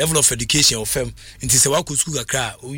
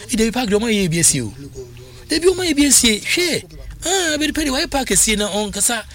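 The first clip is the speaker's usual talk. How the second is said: excited outburst; 8 semitones higher than usual. Retold or imitated. Excited retelling of Abedi Pele.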